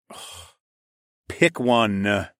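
put-upon huff, then a drawl; bored drawl. A narrator's reading. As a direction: say it as if speaking is a bother. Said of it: "I drawled, like having to actually speak ... was an inconvenience".

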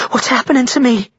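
high, frightened woman's voice; scared and pleading, pitched well up; trembling. A narrator's reading. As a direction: ask in rising panic. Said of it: "her voice trembling".

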